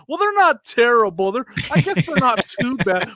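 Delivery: put-on grudgingly upgrade the verdict, as a young negotiator; imitated kid haggler. Seller imitating a boy walking over with cards, haggling kid. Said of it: "as he's walking towards me".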